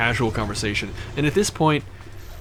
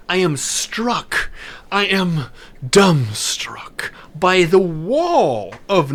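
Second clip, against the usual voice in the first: dramatic slowdown; 3 semitones higher, 3 dB louder, much slower than usual. Acted. Dramatic beat entering loud party.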